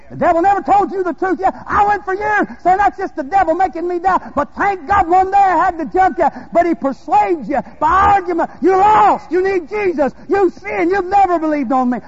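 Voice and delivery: loud, high, fervent preaching cry; full-force preaching. Real, not acted. Preacher urging the congregation to draw from their own well, full-force preaching.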